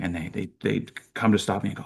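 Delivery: usual speaking voice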